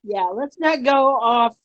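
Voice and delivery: laughing. Real, not acted. Joking about staying on topic.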